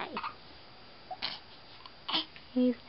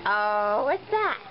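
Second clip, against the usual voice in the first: ordinary talk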